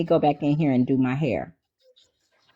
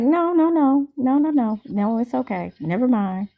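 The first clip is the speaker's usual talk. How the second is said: 1 mock airy brush-off; 10 semitones higher than usual. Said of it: "I'm like".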